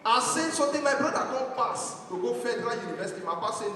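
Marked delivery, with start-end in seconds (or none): none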